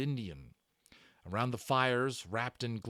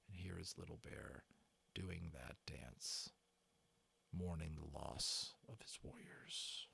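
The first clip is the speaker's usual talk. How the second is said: hushed aside; 6 semitones lower, 15 dB quieter than usual. Real, not acted. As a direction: lean in and whisper to the listener. Whispered aside over dance description.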